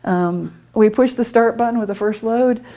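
usual speaking voice

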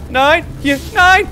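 high, loud alarmed no; alarmed shout. Real, not acted. Gamer fighting the boss, alarmed shout. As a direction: yelp as the attack lands.

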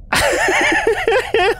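shrieking giggle; high burst of laughter. Real, not acted. Burst after outburst.

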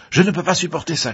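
fast, high, loud protest; shouted outburst. A narrator's reading. Elliot cannot bear the hysterical laughter, shouted outburst. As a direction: shout it out quickly, at breaking point. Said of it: "cria-t-il".

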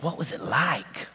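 hushed question. Retold or imitated. Old man leans in and asks the children, hushed.

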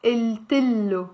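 "Il tillo" slowly, with a held double L; slow "Il tillo", t opening the second part. Shown as a demonstration. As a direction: link with a short i and lengthen the l in "tillo". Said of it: "saying the I and the double L".

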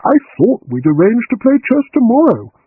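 surprised. A narrator's reading. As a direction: caught off guard, rising. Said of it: "came the surprised voice".

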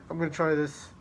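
usual speaking voice

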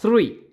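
"three" with a lisped Spanish z, like "thrui"; pronunciation demo. Shown as a demonstration. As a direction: start "three" with a th sound like the Spanish z. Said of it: "como con una Z al inicio".

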